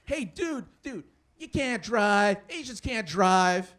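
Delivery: drawn-out, loud heckler voice; mock jeering local, loud and slow; Angry Bob. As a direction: taunt a driver, stretching the words. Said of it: "he was just like".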